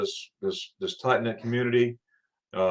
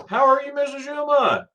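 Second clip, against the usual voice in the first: singsong greeting; 3 dB louder than usual. Real, not acted.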